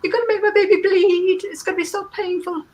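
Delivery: hammed-up weepy mother fretting over her baby's blood; mock crying mother pleading, high and breaking, fading at the end. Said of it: "the mother will start crying".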